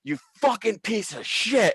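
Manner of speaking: high-pitched retold rage; furious outburst. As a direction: lash out at the boss.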